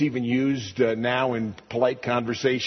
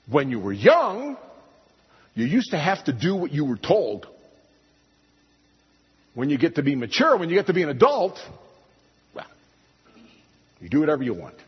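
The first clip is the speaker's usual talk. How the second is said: imitating common saying; 3 semitones higher, faster than usual. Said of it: "What would we say?".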